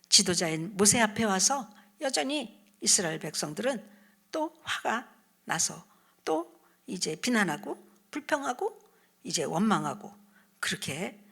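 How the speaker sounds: normal talking voice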